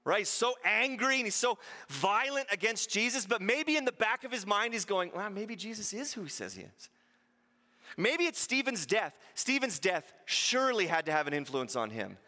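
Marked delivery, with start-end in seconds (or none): 5.1-6.9 much softer, musing to himself; quiet inner doubt. Pretend. Saul doubting in the back of his mind, much softer. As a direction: let the doubt slip out under the breath.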